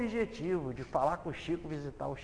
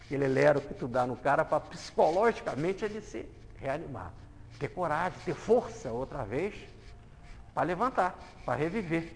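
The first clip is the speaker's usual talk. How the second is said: ordinary talk, nothing marked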